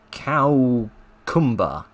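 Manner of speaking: cowcumber drawn out very slowly, first syllable like cow; Cockney cowcumber. Retold or imitated. Reading the Cockney speaker's order, slowly saying cowcumber. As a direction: stretch out cowcumber, rhyming with cow. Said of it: "Do you mean cucumber?".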